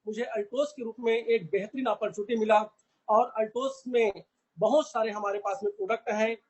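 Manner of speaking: ordinary delivery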